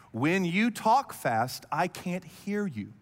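measured, spaced-out delivery; slow right down so each word lands; slow, deliberate rebuke. Retold or imitated. Wife telling her fast-talking husband, slowly and deliberately.

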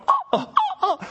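high squeaky mock gasps, laughing; shocked falsetto. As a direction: gasp like a scandalized eavesdropper. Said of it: "they're going".

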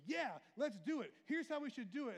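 loud, high eager shout; eager Peter. Retold or imitated. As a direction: jump in with big enthusiasm. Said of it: "And then he go".

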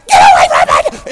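loud, rough full-force yell; screaming at a bear. Retold or imitated. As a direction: scream to drive the animal off. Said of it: "she starts screaming at the bear".

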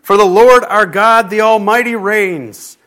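big, loud shout of praise; roaring scripture proclamation; thunderous crying out. A narrator's reading. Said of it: "like the roar of many waters".

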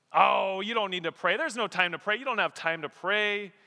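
hammed-up voice of nagging excuses; mock persuasion. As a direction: coax them out of it with false sympathy. Voices the enemy's tempting lies.

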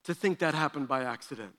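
low, soft, quick disbelief; incredulous. Retold or imitated. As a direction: mutter that nobody could believe this. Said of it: "I'm like".

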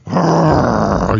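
a sudden loud hammed-up roar; mock roar. Preacher mocks a lamb that roars, loud mock roar. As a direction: roar like a cartoon monster. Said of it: "a little lamb that goes".

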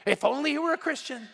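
imitated nagging wife, high; complaining. Wife going on about her husband, complaining. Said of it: "She's just going on and on".

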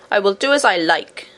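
lightly voiced pouting queen; a stubborn queen sulking back at her husband; sulky queen. A narrator's reading. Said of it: "retorted the queen sulkily".

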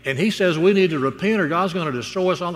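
high, eager rush to tell Dad; hammed-up excited child; excited little boy. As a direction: blurt out the news like a thrilled kid. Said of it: "And the boy's all excited".

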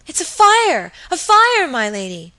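high, loud cry of fire; alarmed outcry. A narrator's reading. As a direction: shout the alarm in rising panic. Terrified maid spots a fire.

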